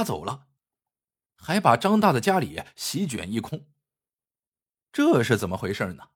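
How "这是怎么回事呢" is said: usual speaking voice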